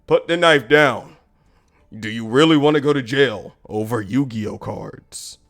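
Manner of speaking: low, authoritative voice; superhero manager. Retold or imitated. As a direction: talk down an armed man like a hero. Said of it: "this manager acting like a superhero".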